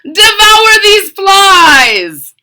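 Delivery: high, loud, slow order; booming mad doctor order; mad scientist command. Acted. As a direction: command the monster.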